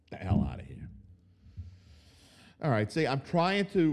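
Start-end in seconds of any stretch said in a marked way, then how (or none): 0.1-0.9 deep low scoffing mutter; low dismissive grumble. Real, not acted. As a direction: toss it away low.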